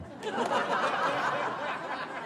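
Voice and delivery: soft airy laugh at the memory; quick breathless chuckle; amused breathy laugh. Real, not acted. Amused at stranger's greeting.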